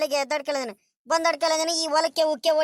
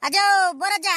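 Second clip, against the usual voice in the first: loud, high call to someone; 5 semitones higher, 5 dB louder than usual. Acted. Driver hailing old farmer across the field, calling out. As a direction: call out to the old man, raised pitch.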